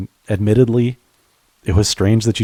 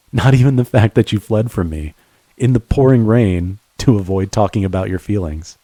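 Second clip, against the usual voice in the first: ordinary talk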